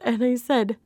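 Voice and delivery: normal talking voice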